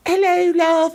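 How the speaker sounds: very high sweet mock wife voice; wife's greeting. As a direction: coo it like a doting wife. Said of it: "his wife just goes".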